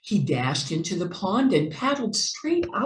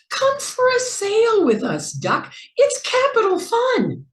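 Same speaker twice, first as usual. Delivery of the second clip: much higher, louder call to a friend; 5 dB louder than usual. A narrator's reading. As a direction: sing out the invitation. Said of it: "Mouse and Jack Rabbit called".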